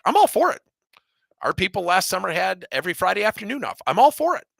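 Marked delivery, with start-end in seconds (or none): 0.0-0.6 much higher and louder burst of enthusiasm on all; emphatic approval. Real, not acted. Enthusiastic endorsement of four-day week. 3.9-4.5 much higher and louder burst of enthusiasm on all; emphatic approval. Real, not acted. Repeats enthusiastic endorsement.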